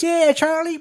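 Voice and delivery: high, loud supportive whoop; hammed-up excited cheer; mock cheering. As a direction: holler support to a fellow gunman. Billy cheers on Charlie's shooting.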